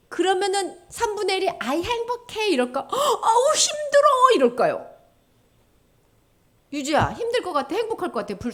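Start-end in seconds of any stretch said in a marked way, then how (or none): 1.6-2.5 높은 음으로 행복한 척. Acted. Acted happy reply in fire. 2.9-4.4 high, loud mock wail of hardship; 힘들어하는 연기. Acted suffering reply in fire.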